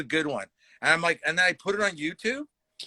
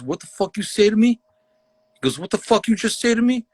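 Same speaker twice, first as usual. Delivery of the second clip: higher, indignant challenge to the comic; imitated angry heckler; 7 semitones higher, 4 dB louder than usual. Heckler confronts the comic onstage, angry imitation. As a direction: act out an affronted heckler, pitched up.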